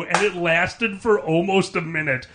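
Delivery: usual speaking voice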